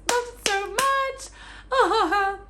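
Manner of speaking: high, stretched-out squeal of love; squealing fangirl adoration; overwhelmed gushing. Real, not acted. Gushing over favorite book.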